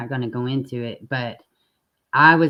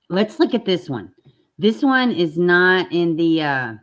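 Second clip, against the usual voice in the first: ordinary delivery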